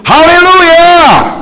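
long, high, full-voiced shout of "哈利路亚", stretched slowly; loud cry of praise. Preacher reenacting shouting to lift his morning mood, in a long high joyful shout. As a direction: let the hallelujah ring out at full volume. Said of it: "我就很大声说：".

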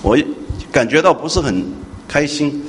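normal talking voice

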